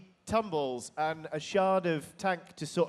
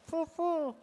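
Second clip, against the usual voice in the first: high dying voice; 12 semitones higher than usual. Acted. Dying creature speaks.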